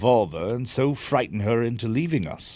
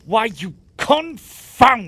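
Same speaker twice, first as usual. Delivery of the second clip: much higher and louder, slow and sputtering; enraged shout; 11 semitones higher, 7 dB louder, much slower than usual. Acted. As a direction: explode at him, then get cut off. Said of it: "Don't be noisy".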